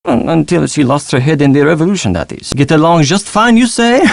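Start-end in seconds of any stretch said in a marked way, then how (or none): none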